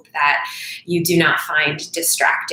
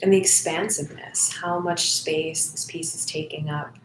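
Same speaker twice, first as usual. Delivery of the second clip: soft, unhurried meditation voice with long pauses; guided meditation; 4 dB quieter than usual. Acted. Volunteer leads online listeners into noticing breath and body, guided meditation. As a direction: guide them gently inward, phrase by phrase, leaving silences.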